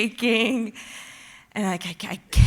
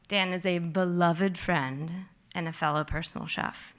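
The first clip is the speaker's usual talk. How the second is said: normal talking voice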